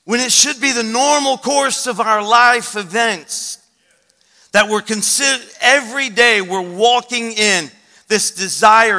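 loud, high, driving proclamation; fervent, punched pulpit delivery; preaching full force. Real, not acted. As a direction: declare it to the whole church with urgency.

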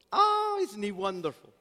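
put-on very high, gushing old lady; Rosie's delight. Pastor voicing Rosie's delighted answer about Jesus. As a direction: squeal it with joy like Rosie. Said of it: "Rosie's delighted reply".

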